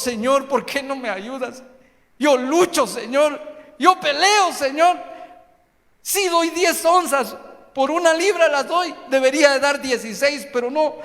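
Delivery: loud, high, pleading complaint to God; desperate cries. Acted. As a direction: cry out like someone drowning. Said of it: "damos gritos de ahogados".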